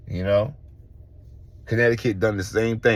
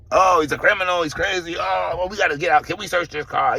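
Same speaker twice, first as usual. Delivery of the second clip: mock cop; 10 semitones higher than usual. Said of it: "they're looking at this false record".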